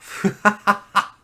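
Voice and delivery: high loud villain giggle; evil giggle. Pretend. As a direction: cackle like a cartoon villain. Gloating over being fiendish.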